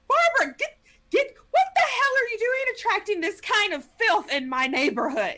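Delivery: high, loud furious telling-off; angry scolding. Acted. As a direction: scold her, outraged. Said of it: "is just like".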